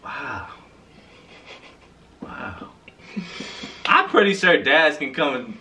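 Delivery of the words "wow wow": much softer flat wow; deadpan whisper of disbelief; groaning at a pun. Real, not acted. Deadpan after chicken sedans pun.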